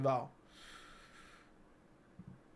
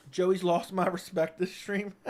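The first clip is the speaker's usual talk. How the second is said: usual speaking voice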